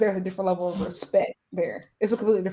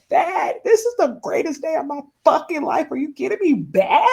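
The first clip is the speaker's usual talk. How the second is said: high, loud, laughing-crying outburst; re-living the overjoyed phone call to a best friend, laughing through tears; elated disbelief; 5 semitones higher, 4 dB louder than usual. Retold or imitated. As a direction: burst out half laughing, half sobbing. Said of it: "broke down sobbing".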